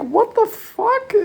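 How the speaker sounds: very high, slow, stretched disbelief; mock shocked squeal. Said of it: "And I'm like".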